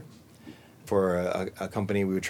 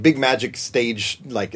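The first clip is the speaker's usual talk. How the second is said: usual speaking voice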